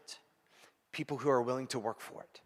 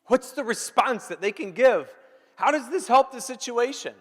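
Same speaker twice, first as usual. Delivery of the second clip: much higher, louder, fervent; passionate high preach; 7 semitones higher, 5 dB louder than usual. Acted. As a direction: rise to an excited peak.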